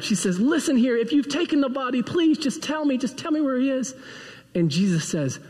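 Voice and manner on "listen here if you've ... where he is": high, pleading mock annoyance; a little mad. Mary confronts the supposed gardener, acted a little mad and higher. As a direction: demand an answer in a raised, mildly cross voice. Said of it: "she gets a little mad".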